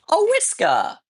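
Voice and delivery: high affectionate call; calling the cat. Real, not acted. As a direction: sing out the cat's name.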